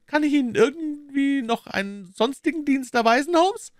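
high, thin pinched voice for the villain; sly villain in a high falsetto; Smith's high voice. Acted. Smith mocks Holmes.

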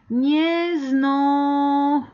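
long held syllables, left unfinished; drawn-out prompt. Shown as a demonstration. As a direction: stretch it out and leave the ending open. Alarga la palabra sin terminarla. (sílabas alargadas, sin terminar)

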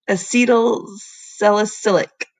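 "Acetylsalicylic" said slowly, syllable by syllable; careful slow retry. Shown as a demonstration. Teacher retrying a hard word for the kids, slow and careful. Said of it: "I'm gonna say it again".

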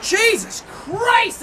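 slow, drawn-out startled oath. Real, not acted. Startled by loud noise.

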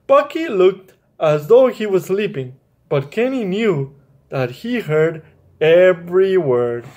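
put-on children's story narration; reading a story to children. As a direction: read it to a child at bedtime. Reads children's story aloud.

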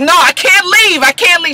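high, loud desperate whine; cling and beg; pleading Frank. Retold or imitated. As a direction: Frank begging Bonnie to let him stay, high and whiny. Frank begging to stay.

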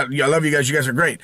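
normal talking voice

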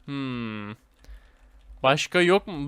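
ordinary talk

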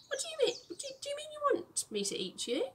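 baffled fox. Acted. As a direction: sputter in total disbelief. Fox baffled by rabbit's cheer.

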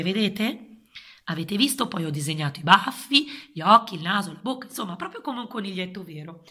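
ordinary delivery

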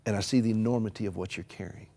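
gentle whispered reassurance; quiet and gentle. Shown as a demonstration. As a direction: say it very gently, like comforting someone. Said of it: "And you say quietly".

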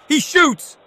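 rising to a high roar; long goal yell. Real, not acted. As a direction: build from shot to roaring score. Goal call.

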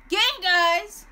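loud call to the team; rallying shout. Real, not acted. Gamer sending teammates at the monster, rallying shout. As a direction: shout the attack order, drawn out.

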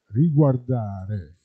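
usual speaking voice

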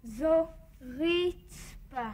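slow, word drawn out to teach. Acted. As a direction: spell it out for a learner. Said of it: "teaching grandma words".

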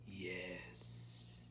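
low, soft answer; hushed, low certainty; calm confirmation. A narrator's reading. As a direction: confirm quietly, unmoved. Grandmother confirms grimly.

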